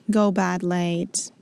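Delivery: ordinary delivery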